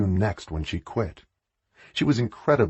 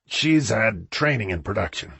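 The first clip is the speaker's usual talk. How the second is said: usual speaking voice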